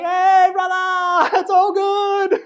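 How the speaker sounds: loud, high, slow stretched call to the driver; calling out cheerfully. Retold or imitated. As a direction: shout it out friendly and laughing. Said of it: "I was like".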